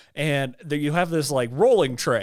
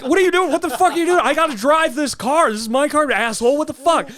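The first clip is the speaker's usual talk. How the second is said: much higher, louder yelled rant; retold screaming; 10 semitones higher, 3 dB louder, faster than usual. Yelling at the guy fixing his car, high furious shout. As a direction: scream at the mechanic like you've lost your mind. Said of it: "I start screaming at him".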